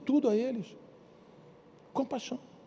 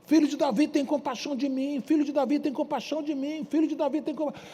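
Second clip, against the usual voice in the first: crying out; 7 semitones higher than usual. Acted. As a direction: beg for mercy at full voice. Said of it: "ele gritava, ele berrava".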